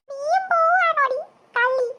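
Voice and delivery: slow, high hammed-up wail; wailing accusation. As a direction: cry after her as she deserts you. Boy wails as sisters desert him.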